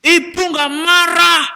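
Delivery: mother's hammed-up shouted denial; loud angry denial. Accused mother answering her child, loud indignant denial. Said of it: "malah tambah napa? Seru".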